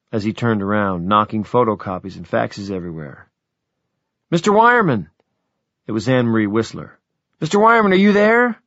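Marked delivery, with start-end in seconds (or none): 4.3-5.1 high, loud urgent call over the intercom; agitated call. Acted. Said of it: "She sounded agitated". 7.4-8.6 high, loud call, tinny like an intercom; agitated call. Acted. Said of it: "She sounded agitated".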